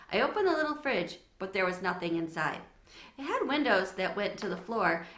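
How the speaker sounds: plain reading voice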